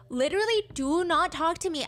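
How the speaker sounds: mildly indignant, pitched high; angry text voice. Retold or imitated. Girlfriend texting boyfriend to back off, high and annoyed. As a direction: raise the pitch in annoyed protest.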